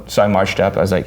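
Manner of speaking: normal talking voice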